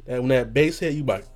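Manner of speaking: normal talking voice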